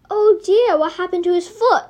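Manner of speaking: high, loud worried voice; concerned surprise. A narrator's reading. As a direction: gasp with concern. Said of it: "Mary questioned".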